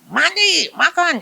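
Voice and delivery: barked commands, pitched high; strict parent barking chores; drill sergeant. Retold or imitated. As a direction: bark orders at the kids like a sergeant. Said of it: "drill sergeant parent".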